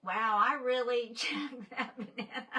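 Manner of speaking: ordinary talk, nothing marked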